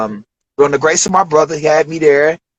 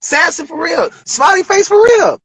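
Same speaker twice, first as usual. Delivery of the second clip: hyped-up Dave Chappelle. Retold or imitated. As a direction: sell the weed like a proud showman. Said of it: "He's like".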